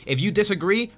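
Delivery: angry rant. Real, not acted. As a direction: jab each word at the listener.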